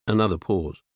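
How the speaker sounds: ordinary delivery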